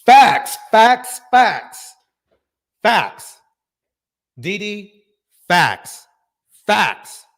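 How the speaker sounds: loud repeated hype shout of facts; shouted facts, pumped up; hyped shouting. Real, not acted. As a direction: bellow it like a hype man agreeing. Hype reaction to guest's point.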